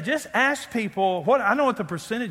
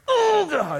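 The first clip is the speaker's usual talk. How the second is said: high, strained groan of a prayer; hammed-up serious prayer; mock straining prayer; 11 semitones higher, 3 dB louder than usual. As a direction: strain like pushing hard. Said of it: "if you're really serious, you go".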